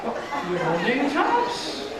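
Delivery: normal talking voice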